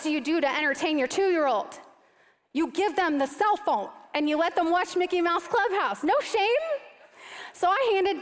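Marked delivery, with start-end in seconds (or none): none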